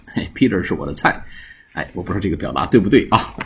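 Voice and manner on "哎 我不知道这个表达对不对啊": ordinary talk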